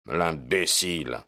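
slow, understated bitterness; bitter. A narrator's reading. Detective on the informant who missed his chance, mildly bitter. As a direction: draw out the insult with a touch of bitterness. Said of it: "dit Holmes avec amertume".

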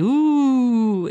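imitated ouh. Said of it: "le ouh".

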